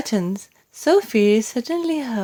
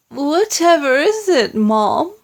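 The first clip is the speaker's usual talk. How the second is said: maid; 7 semitones higher, 4 dB louder than usual. A narrator's reading. Maid asks the Queen.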